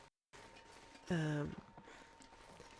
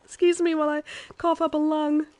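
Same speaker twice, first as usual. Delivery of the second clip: laughing; 11 semitones higher, 11 dB louder than usual. Real, not acted. Laughing and coughing after laughing.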